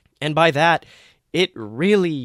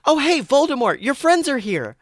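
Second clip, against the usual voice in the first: much higher, bright cheer; cheerful call; 12 semitones higher, 3 dB louder than usual. A narrator's reading. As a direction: announce the friends gleefully. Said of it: "cheered his assistant".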